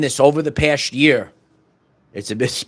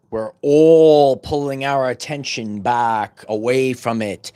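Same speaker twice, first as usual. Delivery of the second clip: slow sermon; 4 semitones lower, slower than usual. Acted. Preaching, slowed down.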